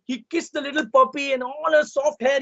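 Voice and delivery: plain reading voice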